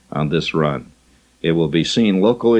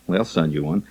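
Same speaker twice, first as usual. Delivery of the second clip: usual speaking voice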